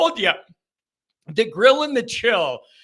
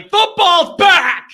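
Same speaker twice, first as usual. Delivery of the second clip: excited shout; 8 semitones higher, 8 dB louder, much slower than usual. Real, not acted. Host announcing football's return.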